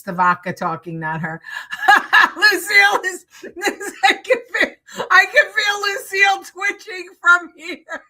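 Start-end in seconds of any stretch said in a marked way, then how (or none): 1.9-8.0 high-pitched laughter through the words; giggling loudly at a viewer's joke; laughing. Real, not acted. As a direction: laugh it out loud, barely getting the words through. Laughing at a chat comment.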